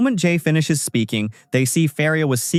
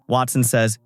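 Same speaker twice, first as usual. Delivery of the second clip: ordinary delivery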